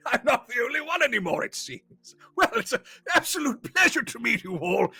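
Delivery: put-on high, loud, hearty old wizard voice; jovial wizard. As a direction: beam with jolly old-world charm. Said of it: "he goes".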